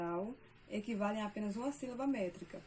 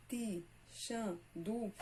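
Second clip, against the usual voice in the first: "ti, chan, du" said as separate beats; syllables split apart; much slower than usual. Shown as a demonstration. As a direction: break the sounds into metrical pieces. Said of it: "essa sílaba aqui destacada".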